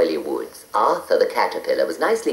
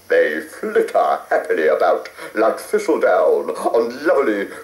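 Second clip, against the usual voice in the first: poetic. A narrator's reading. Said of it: "all poetic".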